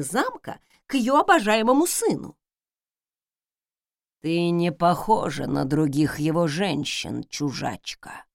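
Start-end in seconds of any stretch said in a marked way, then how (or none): 4.2-8.2 low, hushed ghostly rustle; rustling ghost whisper. A narrator's reading. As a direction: breathe it like a dry ghost. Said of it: "прошелестела она".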